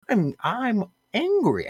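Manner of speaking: high, drawn-out comic angry voice; Adam Sandler impression. Podcaster attempting an actor's angry voice, badly faked. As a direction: stretch out a badly faked, high-pitched anger. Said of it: "can't do a good Adam Sandler impression".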